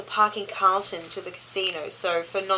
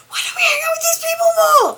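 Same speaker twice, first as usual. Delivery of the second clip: excited; 8 dB louder than usual. Retold or imitated. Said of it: "Bobby was like".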